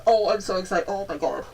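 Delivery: usual speaking voice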